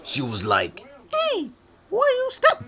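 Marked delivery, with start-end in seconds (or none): 1.1-2.6 put-on squeaky high girl voice; girl's voice. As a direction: pitch way up for the girlfriend. Girl voiced in absurd story.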